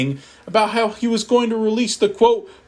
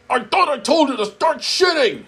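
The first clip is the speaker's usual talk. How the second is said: higher, ranting mock scream; 8 semitones higher than usual. Nurse Eggman yelling at the narrator in the hospital, hammed-up scream. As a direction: yell it like a furious villain. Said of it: "screamed Nurse Eggman".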